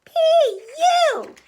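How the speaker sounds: loud, very high squeal of disgust; gagging baby bear. A narrator's reading. Baby Bear smells the perfume, disgusted squeal. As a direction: squeal it out, revolted. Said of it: "Baby Bear gagged".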